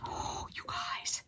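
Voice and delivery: hushed, slow delighted whisper. Real, not acted. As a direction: whisper it, savouring the smell. Whispers over the smell.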